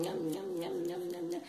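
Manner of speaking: soft nom-nom imitation; quiet yummy smacking sounds; greedy munching noise. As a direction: mimic gobbling under the breath. Granny craves popcorn.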